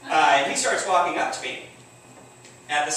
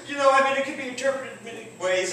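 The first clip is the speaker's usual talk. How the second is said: nervous placating reply; 9 semitones higher, faster than usual. Retold or imitated.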